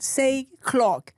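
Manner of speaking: high mock British mum; British mother. British woman asking child to repeat, high British accent. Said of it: "what's a British word?".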